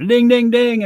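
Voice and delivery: high loud dinging; imitated bar bell; bell imitation. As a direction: chime it like a bell.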